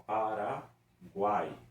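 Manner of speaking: "Paraguai" very slow to show the vowels; drawn-out example. Shown as a demonstration. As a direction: stretch out Paraguai. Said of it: "três vogais juntas na mesma sílaba". ("Paraguai" bem devagar, esticando as vogais)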